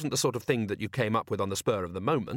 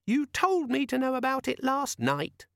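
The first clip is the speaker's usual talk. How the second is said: very high, smug little squeak; squeaky demon voice; 14 semitones higher than usual. Acted. The demon contradicting Vimes, smug squeak. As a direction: answer like a smug gadget.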